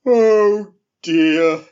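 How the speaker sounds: slow, loud, lumbering bear voice; a big drowsy bear just woken, yawning through it; sleepy friendly bear. Acted. The bear asks the elf for soup, slow and sleepy.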